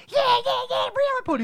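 put-on very high, loud bratty kid voice; obnoxious kids. Imitating the bratty movie kids, high and loud. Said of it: "They're like".

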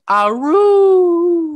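high, slow, howling "I'll rule"; wolf voice. Retold or imitated. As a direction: howl the words like a wolf girl. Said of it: "sounds like she's a wolf girl going".

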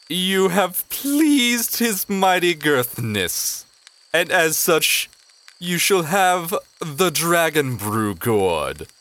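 high, slow, pompous goblin herald; Slorb's goblin voice. Acted. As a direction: announce grandly like a goblin courtier. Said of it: "Slorb goes".

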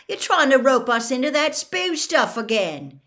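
catching her out; accusing. Acted. Ron accusing Hermione.

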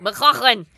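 McLaughlin, loud, with a rough throaty "ch" in the middle. Shown as a demonstration. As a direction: say McLaughlin with lots of huck in the throat. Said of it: "I like all the huh in it".

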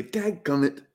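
mock Southern hunter's "Dadgummit"; a frustrated hunter's "Dadgummit" in a Southern twang; Southern exclamation. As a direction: hunter grumbling when deer don't show, Southern twang. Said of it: "when they don't come around, I say".

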